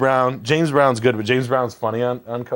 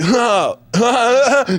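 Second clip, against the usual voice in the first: mock panting; 11 semitones higher, 4 dB louder, much slower than usual. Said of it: "And it was like".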